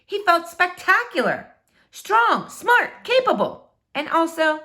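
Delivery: climbing, thrilled list; rising excitement. A narrator's reading. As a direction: build it up proudly. Building list of strengths.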